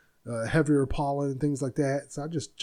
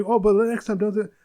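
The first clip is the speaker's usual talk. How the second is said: mock doctor scolding; 6 semitones higher, 6 dB louder than usual. Doctor quote repeated, exaggerated.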